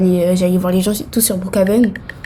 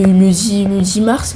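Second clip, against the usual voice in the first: usual speaking voice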